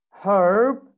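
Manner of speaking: American "herb" with H and R; American pronunciation. Shown as a demonstration. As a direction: say "herb" with both H and R sounded. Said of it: "In American accent".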